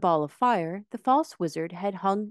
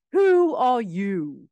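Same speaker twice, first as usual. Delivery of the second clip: slow, louder challenge; cried out; 6 semitones higher, 7 dB louder, much slower than usual. A narrator's reading. Tin Woodman confronting the little man, crying out. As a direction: call it out raising an axe. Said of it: "cried out".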